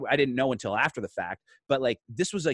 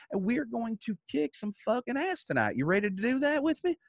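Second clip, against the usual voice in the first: put-on higher, confident old veteran laughing it off; old man voice; 9 semitones higher than usual. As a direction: pump the kid up like a seasoned old pro. Old man reassuring the new kid.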